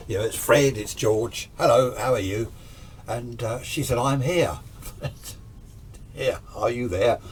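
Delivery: ordinary talk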